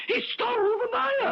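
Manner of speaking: high sobbing wail. Acted. Designer mourning his stolen dress, sobbing. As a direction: break into a wail of grief.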